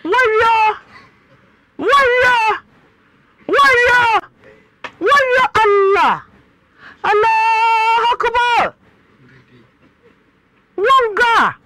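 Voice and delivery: very high, full-voiced shouts; loud crying out. Real, not acted. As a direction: belt out each exclamation high and long. Host cries out repeated exclamations.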